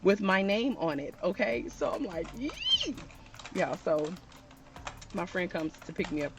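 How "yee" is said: very high-pitched "yee"; excited squeal. Retold or imitated. Storyteller reliving her thrill for her viewers, excited squeal.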